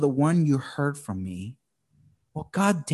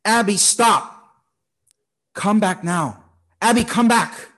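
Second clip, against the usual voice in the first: urgent call; 6 semitones higher, 5 dB louder than usual. Retold or imitated. Father calling Abby back from a busy street, urgent loud command. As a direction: stop her dead before the street. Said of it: "That's what I say when she lets go of my hand when we're crossing a busy street".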